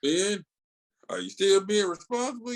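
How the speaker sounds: lightly hammed-up fatherly check-in; Uncle Ben. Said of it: "see Uncle Ben".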